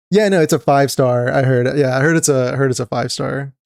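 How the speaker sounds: ordinary delivery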